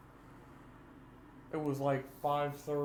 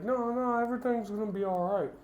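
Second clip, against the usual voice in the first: mom's breezy reassurance; 8 semitones higher, 3 dB louder than usual. Retold or imitated. Mother brushing off son's fear.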